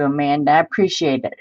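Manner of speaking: normal talking voice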